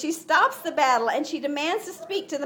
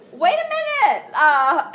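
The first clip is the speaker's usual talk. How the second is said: high, loud mock yell; mock scream; 4 semitones higher, 8 dB louder than usual. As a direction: yell from the wall for attention, half joking. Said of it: "at the top of the wall screaming".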